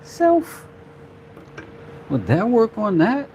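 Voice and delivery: high, comic question to oneself; mock self-talk. Maker asking himself about an idea, high mock self-talk. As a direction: ask yourself in a silly, lifted voice. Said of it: "And I says to myself".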